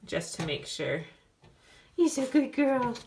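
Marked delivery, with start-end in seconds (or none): 1.9-2.9 high, slow cooing at a puppy; squeaky praising a dog. Real, not acted. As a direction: coo at the puppy. Checking in on puppy.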